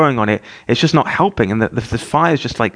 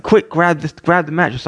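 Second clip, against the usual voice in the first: higher, mildly urgent command; retold urgent order; 6 semitones higher than usual. Neighbor to dad at the fire, retold urgent order. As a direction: re-enact a quick instruction in an emergency.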